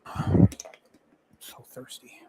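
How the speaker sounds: breathless whispered complaint; whispering to himself. Real, not acted. Tired setup work, whispered aside.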